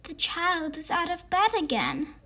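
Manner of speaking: chirpy. A narrator's reading. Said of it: "chirped the red monster".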